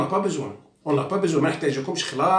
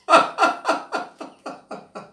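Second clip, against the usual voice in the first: a high, breathy burst of real laughter; breathless laugh; 13 semitones higher than usual. Real, not acted. Laughing at the imams' demands.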